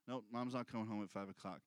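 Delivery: low, fast answer; father answering his son, low and fast; quick parental reply. Retold or imitated. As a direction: brush it off quickly. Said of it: "father's reply".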